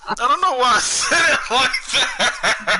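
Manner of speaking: giggling through the words, high and slow; real embarrassed laughter; laughing. Real, not acted. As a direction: laugh at yourself while talking. Laughing at his own voice.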